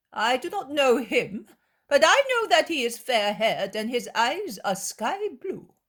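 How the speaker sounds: high, loud incredulous questions; feigned puzzlement. Acted. Scribe baiting her with false questions, incredulous. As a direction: play dumb, baiting her.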